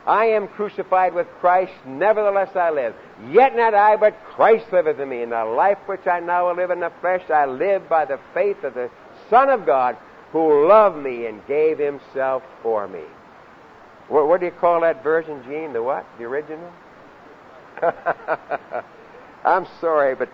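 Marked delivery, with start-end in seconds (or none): 0.1-13.0 emphatic verse recited from memory; scripture recitation. Real, not acted. 17.8-18.8 short chuckle in rapid bursts; laugh. Real, not acted. Laughs about Bible versions.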